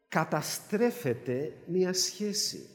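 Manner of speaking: drawn-out, hushed repeat with the stress on μια; slow and soft. Real, not acted. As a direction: let it sink in slowly. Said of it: "repeated slowly and softly".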